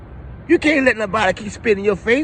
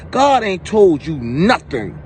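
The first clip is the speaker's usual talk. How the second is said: ordinary talk, nothing marked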